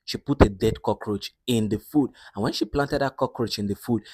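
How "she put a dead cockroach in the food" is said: ordinary delivery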